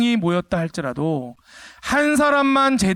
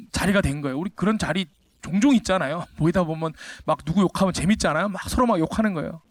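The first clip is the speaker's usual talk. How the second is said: ordinary talk, nothing marked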